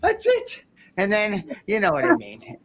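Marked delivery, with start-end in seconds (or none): 0.0-0.7 high, squeaky hammed-up wail; mock tragic wail, pitched way up; mock despair. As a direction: ham up a tragic cry of despair. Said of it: "instead of".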